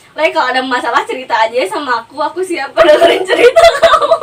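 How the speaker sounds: playful offer to listen, breaking into loud laughter; mock kindly offer. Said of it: "sekarang gue baiknya kayak gini". (pura-pura baik hati, lalu pecah tertawa)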